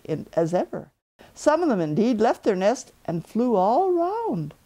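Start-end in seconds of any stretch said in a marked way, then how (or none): none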